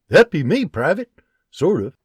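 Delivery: dry, half-joking reply; hint of a Scottish frontiersman; lightly voiced Scottish sergeant. John character voice.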